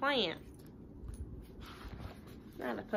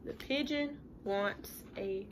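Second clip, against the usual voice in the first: whispered title, hushed and secret; soft storytime whisper; 9 dB quieter than usual. Shown as a demonstration. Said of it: "teacher whispers the title".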